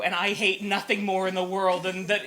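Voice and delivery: ordinary talk, nothing marked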